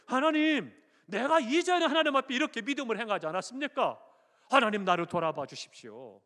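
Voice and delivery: high, loud lament, acted not sobbed; preacher voicing a king's anguished cry; wailing prayer. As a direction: cry out to God in bitter plea. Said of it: "그러니까 심히 통곡하는 거예요".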